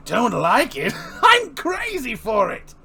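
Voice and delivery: high, loud laughing delight; cackling maniac; manic glee. Acted. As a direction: burst into crazed joy.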